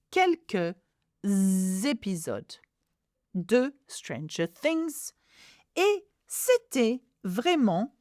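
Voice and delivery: "Quelques épisodes" slowly, buzzing the linking z; deliberate word-by-word French model reading; slow read-aloud demo. Shown as a demonstration. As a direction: sound out each French word separately for learners. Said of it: "learning how to read out loud".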